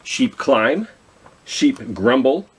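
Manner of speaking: plain reading voice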